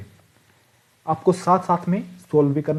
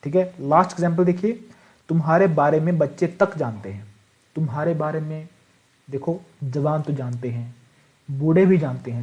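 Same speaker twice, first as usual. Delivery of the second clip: normal talking voice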